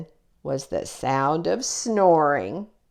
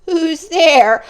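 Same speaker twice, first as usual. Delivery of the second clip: higher, louder nervous call into the dark; trembling call; 9 semitones higher, 8 dB louder than usual. Acted. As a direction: call out bravely but scared. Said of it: "voice trembled as he called out".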